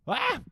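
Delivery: high, loud startled shriek; panicked scream. Real, not acted.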